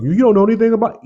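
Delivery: high, loud, fast mock heckler; mocking critic. Imitating a fan who says he knows nothing, high and fast. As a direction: shoot back the critic's jab in a whiny squeal. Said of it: "You know".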